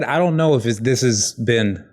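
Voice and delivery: ordinary talk, nothing marked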